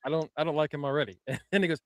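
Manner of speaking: usual speaking voice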